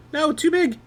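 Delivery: real alarm as it goes wrong; panicked surprise. Real, not acted. Panics at the oversized window.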